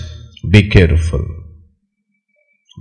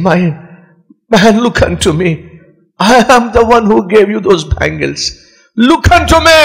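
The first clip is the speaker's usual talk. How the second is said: shouted plea; 6 semitones higher than usual. Acted. Prince shouting to the man below, plea building higher. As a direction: call out, climbing to a high cry. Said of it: "The prince was shouting and saying".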